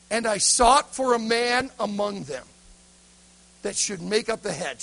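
acted pulpit reading with volume; first rousing reading. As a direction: preach the verse out boldly, stretching it. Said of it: "but he read it differently".

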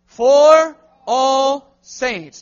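slow, loud unison reading; slow loud call-and-response; congregation reading aloud. Shown as a demonstration. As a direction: drag each word out together. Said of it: "read them together with me out loud".

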